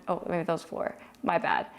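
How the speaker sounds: ordinary talk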